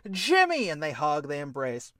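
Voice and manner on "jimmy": high, loud happy shout of his name; joyful cry. Acted. Bimmy seeing brother Jimmy return, joyful high cry. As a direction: greet a long-lost brother.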